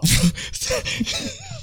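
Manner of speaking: high airy laugh; laughing till you can't talk; wheezing laugh. Real, not acted. Still laughing after time out.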